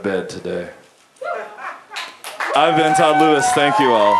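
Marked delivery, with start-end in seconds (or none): 2.5-4.2 loud sign-off. Acted.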